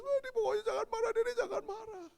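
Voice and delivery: very high whimpering plea; mock childish crying; imitated crying child. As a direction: keep begging tearfully like a kid. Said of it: "Udah nangis".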